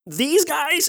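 high, whiny Fozzie-style protest; mock-indignant Fozzie whine; imitating Fozzie. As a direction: squeak it out like an offended bear. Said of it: "And he's like".

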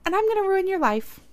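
high-pitched mock kid voice, held up high; recovered kid. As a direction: imitate a kid suddenly bouncing back. Voicing sick kid's sudden recovery.

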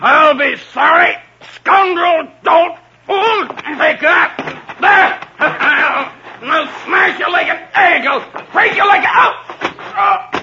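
high furious yelling insults; Guy of Gisborne raging at Robin in battle; raging shouts. Acted. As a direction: roar as you swing wildly.

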